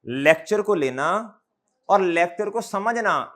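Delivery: slowed right down, each phrase pressed home; slow and stressed. Shown as a demonstration. Teacher stressing the key point to his students, slow and weighty. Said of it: "normal speed से कम पे आके बोलूंगा और जोर दे के".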